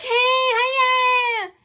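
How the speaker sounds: high, loud, hammed-up fake friendliness; mock cheery greeting. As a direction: shout a sugary fake welcome. Mocking fake friendly staff greeting.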